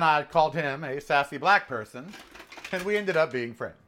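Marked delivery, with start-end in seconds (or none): none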